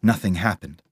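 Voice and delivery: usual speaking voice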